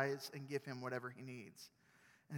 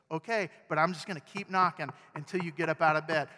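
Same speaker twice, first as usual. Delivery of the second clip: louder, stubborn neighbor; stubborn threat; 2 semitones higher, 9 dB louder than usual. Pretend. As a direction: insist you will keep knocking. Neighbor threatens to keep knocking.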